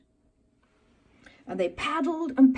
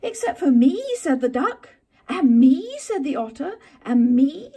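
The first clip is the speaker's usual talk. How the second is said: eager; 5 semitones higher, 8 dB louder than usual. Acted. Friends eagerly volunteering.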